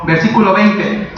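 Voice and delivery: ordinary talk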